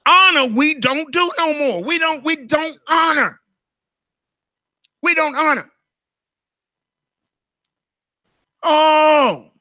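high, loud, preaching cry on honor; fiery preaching. Acted. Pastor pressing honor on the congregation, preaching hard. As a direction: preach it with full force.

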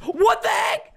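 high, sudden shout of disbelief; real high yelp of bafflement; startled outburst. Real, not acted. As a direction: burst out, baffled by the game. Keeps falling off ladders.

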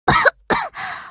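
short coughs as if choking on smoke; rough smoky coughing; coughing fit. Acted. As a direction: cough through thick smoke.

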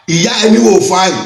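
ordinary delivery

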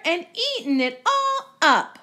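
high, loud little-bear complaint; Baby Bear. Acted. Baby Bear finding the bowl empty, high upset voice. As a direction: wail it up high like an upset cub. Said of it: "said Baby Bear".

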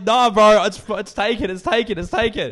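high, loud, repeated protest; retold frantic call. Teller to kid outside occupied toilet, loud high-pitched protest. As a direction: call it out urgently through a shut door.

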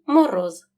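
«мороз» loud, final з kept voiced; clear voiced ending. Shown as a demonstration. Teacher shows pupils the final sound, clearly voiced. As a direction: end «мороз» on a buzzing z, not s. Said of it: "так само вимовляємо чітко".

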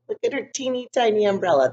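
ordinary delivery

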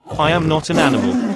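the dragon bristles at the word animal, lightly snarled; snarling dragon. A narrator's reading. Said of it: "snarled Amaron".

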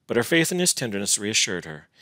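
usual speaking voice

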